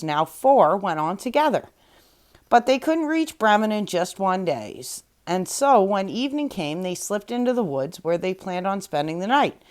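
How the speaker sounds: ordinary delivery, nothing marked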